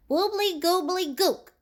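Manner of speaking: high goblin magic chant; casting a silly goblin spell; a secret goblin word spoken lightly. Acted. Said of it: "which sounded like".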